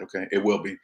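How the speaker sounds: ordinary talk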